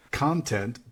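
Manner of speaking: "content" with the opening syllable stressed, CON-tent; stress on first syllable. Shown as a demonstration. Teacher defining what something is filled with, "content" stressed on the first syllable. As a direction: put the weight on CON in "content". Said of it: "syllable you emphasize".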